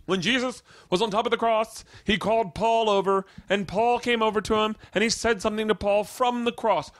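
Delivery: high, far-off put-on teacher voice; religion teacher lecturing the class; teacher's voice. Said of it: "Mister Mercadante says".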